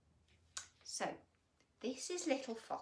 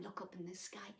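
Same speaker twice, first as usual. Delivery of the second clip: soft unvoiced whisper; 3 semitones lower, 8 dB quieter, faster than usual. Real, not acted. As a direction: hush and whisper it. Whispered aside.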